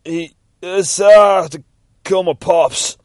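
high, loud strangled admission; hammed-up shark thug breaking; anguished confession. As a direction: choke out the awful truth. Guppy admits the murder plan.

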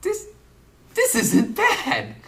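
pleasantly surprised. Retold or imitated. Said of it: "I was like".

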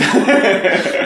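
high burst of laughter. Real, not acted. Laughs at being asked.

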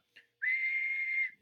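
high, loud squeaky whistle through the mask; piercing whistle imitation; imitated high whistle. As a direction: mimic a broken mask whistling.

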